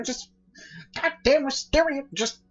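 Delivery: high, exasperated rant ending in a yell; rave, then burst into a loud cry; ranting and raving. Acted. Mad Gadget ranting about wisteria, high and loud. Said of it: "just ranting and raving".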